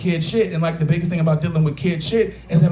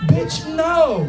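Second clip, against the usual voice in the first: high, drawn-out dismissive no; flat dismissal; 9 semitones higher than usual. Retold or imitated. Comedian retells brushing off his ex-wife, dismissive. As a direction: shut her down with disbelief. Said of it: "I was like".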